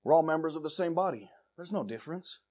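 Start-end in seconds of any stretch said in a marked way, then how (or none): none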